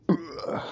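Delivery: unvoiced throaty retch; coughing up blood. All For One impaled, mock choking cough. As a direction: choke out a guttural gurgle. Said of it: "still coughing up blood, like".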